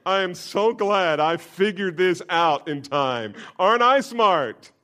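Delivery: high, loud, self-satisfied mock boast; smug boast. Proud believer in heaven boasting, mock smug. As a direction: play a proud fool congratulating himself. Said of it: "stand in heaven and say".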